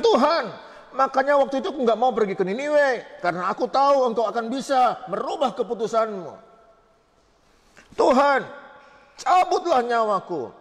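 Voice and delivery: high, complaining angry prayer; angry Jonah. Retold or imitated. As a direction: protest to God like a sulking prophet. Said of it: "Yunusnya marah". (doa Yunus yang marah, nada tinggi)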